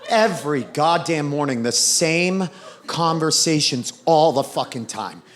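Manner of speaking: normal talking voice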